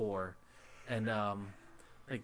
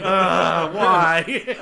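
loud, high, stunned "why"; jaw-dropped disbelief, laughing; shocked disbelief; 6 semitones higher, 15 dB louder than usual. Retold or imitated. Retelling shock at racist voices.

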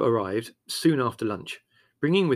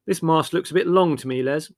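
doubtful; 5 semitones higher, 8 dB louder than usual. A narrator's reading. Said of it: "eyeing it critically".

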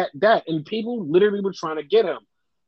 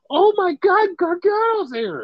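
mock fan excitement. Imitates cheering fans.

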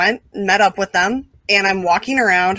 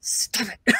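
whispered stop it; teacher shushing a noisy student; hushed scold; 5 dB quieter than usual. Retold or imitated. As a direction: scold in a hiss from the podium. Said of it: "he literally just goes".

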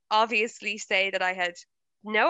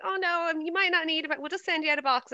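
higher-pitched mock phone agent; customer service agent; 7 semitones higher, 3 dB quieter, faster than usual. Provider's agent brushing off a confused customer, lightly imitated in higher pitch. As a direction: voice a helpdesk worker, pitched up and unbothered. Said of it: "she was like".

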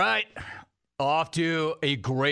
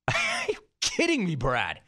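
shocked laughter. Real, not acted. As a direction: laugh it out, stunned. Host stunned by caller's kamikaze joke.

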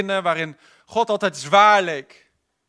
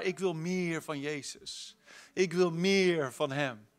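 much softer and slow; whisper-close, slow confession; quiet slow yearning for more of Jesus; intimate longing; 2 semitones lower, 9 dB quieter, slower than usual. Acted.

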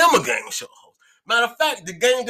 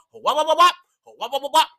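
silly imitated noise; 5 semitones higher than usual.

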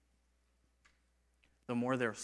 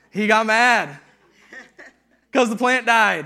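laughing; 7 semitones higher, 15 dB louder, slower than usual. Real, not acted. Laughing at Jonah's anger.

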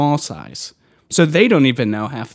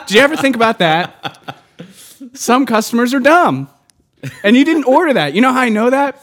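angry laughing rant; 8 semitones higher than usual. Real, not acted. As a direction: vent at dumb customers. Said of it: "I am so mad".

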